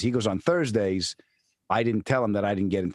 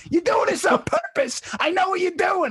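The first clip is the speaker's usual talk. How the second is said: high-pitched accusing shout; mock screaming; 4 dB louder than usual. Friend dodging cars with eggs and coffee, mock screaming. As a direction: imitate a frantic friend screaming. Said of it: "dodging cars and screaming".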